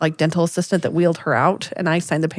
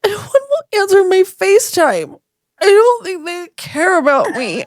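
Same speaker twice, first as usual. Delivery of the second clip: high, drawn-out imitated wail; mock crying; 10 semitones higher, 4 dB louder than usual. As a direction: blubber it in exaggerated self-pity. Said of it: "she starts crying and she goes".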